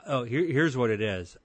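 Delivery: ordinary talk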